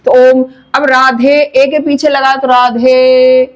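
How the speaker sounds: ओम and राधे with the last vowel stretched long and slow; drawn-out vowels. Shown as a demonstration. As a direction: hold the final vowel of राधे three beats. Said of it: "उसी अक्षर को तीन मात्रा का समय देकर बोलना होता है".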